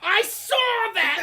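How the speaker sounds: high, drawn-out accusing shout; bus driver shouting. Retold or imitated. Bus driver catching a kid out, loud shout. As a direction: holler it slowly, way up in pitch.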